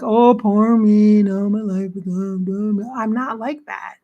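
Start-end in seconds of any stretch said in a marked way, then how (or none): 0.0-2.9 slow, louder whiny mock lament; mock self-pity. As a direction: drag it out like a sulking martyr. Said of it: "I could be like".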